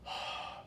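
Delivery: soft breathy exhale on the hand; warm breath blow. Shown as a demonstration. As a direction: breathe out warm air slowly. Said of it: "you can blow warm air".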